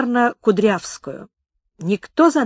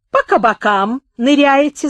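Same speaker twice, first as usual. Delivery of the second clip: scolding sneer; 6 semitones higher, 6 dB louder than usual. A narrator's reading. Sleuth scolding schoolboys.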